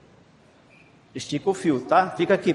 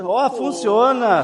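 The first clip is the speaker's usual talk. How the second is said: loud, higher, drawn-out proclamation; excited announcement; 7 semitones higher, 7 dB louder, much slower than usual. Real, not acted. Showing the children the string telephone works, excited announcement. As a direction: show off triumphantly that it works.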